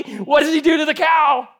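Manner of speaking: ordinary talk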